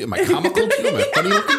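squeaky, laughing high-pitched mockery; mock tough-guy taunt. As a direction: jokingly play the touchy gangster, voice climbing up high. Mock mobster "am I funny" bit.

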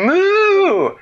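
very high, loud, long moo; emphatic cow. Acted. Cow replying to Dan's attempt, high emphatic moo. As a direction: blast out a high-pitched moo. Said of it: "And the cow said".

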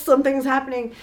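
high-pitched worried alarm; lightly hammed-up fretting; mock panic. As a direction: keep up the pretend alarm. Acts onlookers panicking at crying.

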